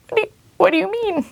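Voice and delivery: high, fast stunned question; what do you mean, half-panicked; shocked disbelief. Retold or imitated. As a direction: sound thrown by the news.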